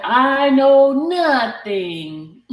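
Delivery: slow, drawn-out mock innocence; mock innocent. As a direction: draw it out like playing dumb. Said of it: "I don't tell".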